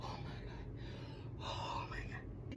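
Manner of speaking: slow, barely audible whisper; whispered dread. Real, not acted. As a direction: whisper it to yourself in real dread. Dreading the pull.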